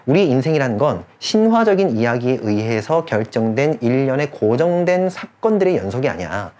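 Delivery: ordinary talk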